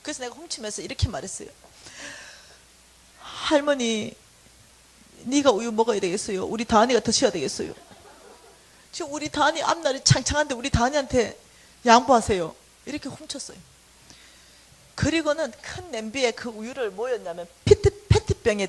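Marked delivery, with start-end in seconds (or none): 3.2-12.5 loud, pushy mock plea to grandma; mock scolding demand. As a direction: bossily tell the old lady to give up her milk. 우유 훔치며 할머니께 하는 말. (할머니한테 우유 양보하라고 큰소리로 우기는 말투)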